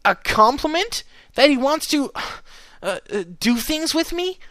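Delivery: high, rising incredulous question; loud, high shock breaking into hesitation; disturbed disbelief. A narrator's reading. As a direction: falter uneasily mid-question. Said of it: "looking somewhat disturbed".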